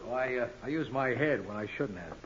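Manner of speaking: rueful. Acted. Battered man, rueful.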